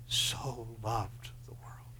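slow, breathy hush of a whisper; hushed, reverent whisper. Acted. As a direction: draw them in with a slow whisper. Preacher drops to a whisper.

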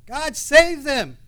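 high, loud, slow anguished plea to God; pleading cry. Acted. Prophet crying out to God.

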